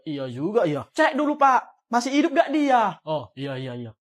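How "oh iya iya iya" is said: quiet mumble. Acted. Teacher mumbles agreement.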